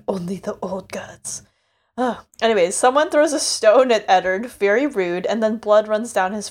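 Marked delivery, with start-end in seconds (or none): 0.1-1.4 hushed whisper. Retold or imitated. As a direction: breathe it out under the breath. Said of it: "he's like".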